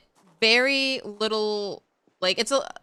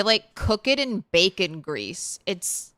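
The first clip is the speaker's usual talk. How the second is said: ordinary talk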